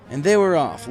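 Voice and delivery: usual speaking voice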